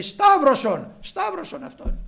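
high, loud demand, easing off; crowd's shout. Retold or imitated. Crowd answering Pilate about Jesus, loud shouted demand. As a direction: cry out like a mob.

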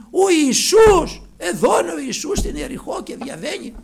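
high, loud, excited announcement; excited crowd. Acted. Townspeople telling the blind man Jesus is passing, excited. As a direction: call out that Jesus is here.

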